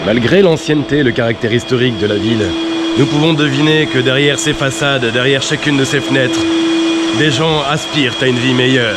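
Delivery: pompous TV documentary voiceover; documentary narration. Acted. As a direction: narrate like a serious reporter.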